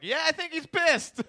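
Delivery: loud, high imitation of an exasperated friend; drawn-out, high-pitched yell of a quoted reply; emphatic retort. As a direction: shout the obvious back at him. Acts friend's emphatic answer.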